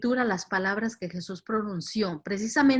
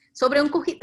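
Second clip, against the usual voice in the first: higher, louder astonished echo; amazed at this detail; incredulous repeat; 7 semitones higher, 6 dB louder than usual. Real, not acted. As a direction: repeat it in raised-pitch disbelief. Repeats the verse in disbelief.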